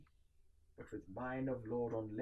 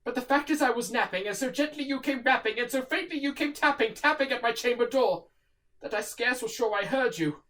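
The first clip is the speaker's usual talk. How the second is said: raised, urgent apology through the door; pleading call; 13 semitones higher, 15 dB louder than usual. Acted. Narrator apologizes loudly to the knocking visitor, pleading. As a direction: call out a hurried excuse to the unseen visitor.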